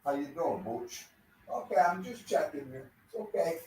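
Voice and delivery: soft pet talk. Real, not acted. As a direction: softly soothe the dog while checking him. Checking on the dog.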